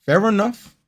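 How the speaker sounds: high, loud put-on voice; high mock voice. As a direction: squeak it out in a silly voice. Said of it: "same words said again differently".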